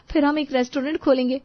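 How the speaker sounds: ordinary delivery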